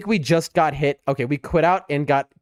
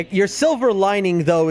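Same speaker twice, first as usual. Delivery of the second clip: ordinary talk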